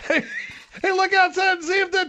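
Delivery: high, put-on yokel call; hammed-up local calling out. Local telling family to look outside, hammed-up calling. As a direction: holler high to someone indoors, mock alarm.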